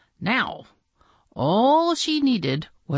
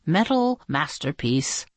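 usual speaking voice

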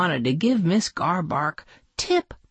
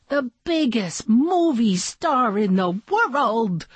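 plain reading voice

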